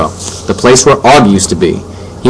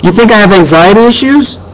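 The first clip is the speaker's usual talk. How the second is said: high-pitched outraged question; indignant yell; 11 semitones higher than usual. A narrator's reading. Boy bursting into his parents' bedroom, indignant. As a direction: climb high in offended disbelief. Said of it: "I yelled from my room".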